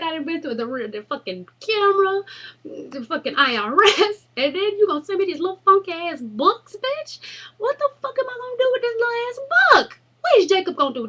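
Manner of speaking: high, loud, fed-up tirade; shrill disbelieving outburst, building to a yell; exasperated rant. Retold or imitated. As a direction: rage at the ridiculous little books. Ranting at the tiny books.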